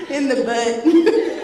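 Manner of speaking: laughing through embarrassment, high and loud; embarrassed laughing story. Real, not acted. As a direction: giggle out the embarrassing part.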